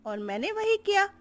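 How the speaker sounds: high-pitched coaxing bahu; daughter-in-law voice. Acted. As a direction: coax her mother-in-law in a high young voice. Tuni voiced.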